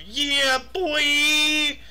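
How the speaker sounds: high, loud hype-man holler. Retold or imitated. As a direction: yell it out long and high. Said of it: "known for his yells of".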